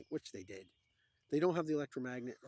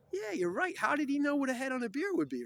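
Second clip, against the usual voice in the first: very high, loud, incredulous realisation; mock amazement; 14 semitones higher, 10 dB louder than usual. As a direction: blurt it out high and astonished. Acted astonished realisation.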